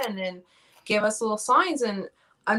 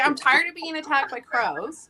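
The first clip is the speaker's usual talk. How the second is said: laughing mock complaint; 5 semitones higher, 4 dB louder than usual. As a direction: laugh through a mock whine.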